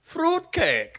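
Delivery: jokey German accent on "Fruit cake"; mock German accent. Shown as a demonstration. Quizmaster asks for it, a silly German accent. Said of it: "Oh, put a German accent on it".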